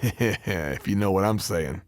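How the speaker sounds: low, slow suggestive chuckle into the line; leering innuendo. Acted. Heel wrestler leering to rivals about Trish, low and suggestive. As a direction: let the dirty joke hang.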